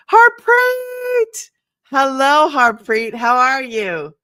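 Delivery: high, loud sing-song call to a friend; light up and call her name out; excited greeting. Real, not acted. Livestream host greets viewer Harpreet, high and loud.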